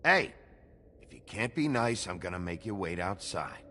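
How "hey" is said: sharp, high, loud "hey"; loud call. Acted. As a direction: cut in loudly to stop them. Barkeep breaks up fight.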